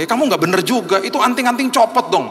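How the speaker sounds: high-pitched exasperated telling-off; animated reproach, acted out; retold scolding. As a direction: scold a friend, pitch climbing. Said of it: "saya juga tegur dia".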